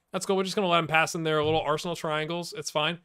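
ordinary talk

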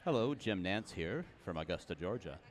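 hushed golf announcer, low and soft; Jim Nantz. Acted. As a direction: murmur it like live golf commentary. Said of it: "I'm talking like a golf announcer".

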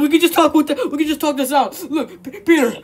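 ordinary delivery

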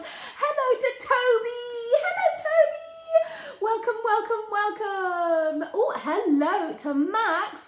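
high, sing-song greeting; excited welcome. Real, not acted. Greeting a newly joined child.

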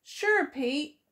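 plain reading voice

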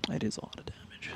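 breathy mock whisper of the meme. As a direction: hush it like a secret. Echoing the meme line.

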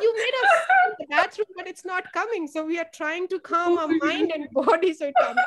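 put-on a sweet child's earnest excuse; innocent kids. Teacher imitating her kids explaining why they sit in the bathroom.

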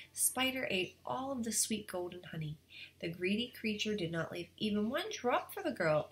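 plain reading voice

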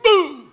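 sudden drawn-out "bum" noise; imitated boom. Preacher telling of a minister hoisting a huge weight, imitated boom. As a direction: make a big booming effect with the voice.

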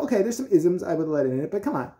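ordinary talk, nothing marked

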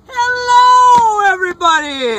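hello yelled high and loud; hyped second hello. Real, not acted. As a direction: bellow it again, bigger. Repeated greeting, even louder.

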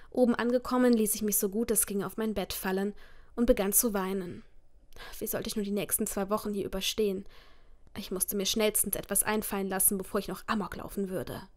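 normal talking voice

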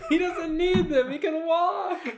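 real high-pitched, laughing yell; laughing shout. Real, not acted. Friend mocks a character's mega legs, laughing shout. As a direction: protest loudly through helpless laughter.

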